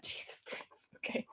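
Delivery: breathy embarrassed whisper. Real, not acted. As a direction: say it under your breath.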